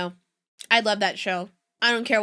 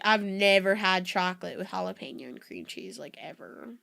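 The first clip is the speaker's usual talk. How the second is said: usual speaking voice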